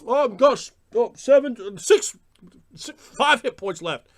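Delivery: mock panicked player counting hit points; loud, high and halting table talk; hammed-up whining over a game score; out-of-character player. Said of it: "still far better than".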